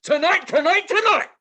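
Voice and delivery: high, loud, rising TONIGHT; monster truck ad. Pretend. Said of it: "a monster truck announcer to do a commercial".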